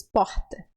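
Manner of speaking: porta with an aspirated, breathy r. Shown as a demonstration. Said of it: "tem gente que diz porta".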